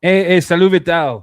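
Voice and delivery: loud, bright Québécois greeting with a French accent. Shown as a demonstration. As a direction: greet him like a Montreal local, French accent. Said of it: "You even have the accent".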